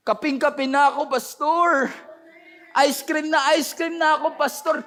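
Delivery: high-pitched craving complaint; mock pleading whine. Congregant craving coffee and ice cream begs pastor, mock whining. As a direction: plead with the pastor like you cannot hold out.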